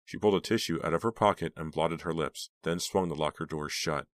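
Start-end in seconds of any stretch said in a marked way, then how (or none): none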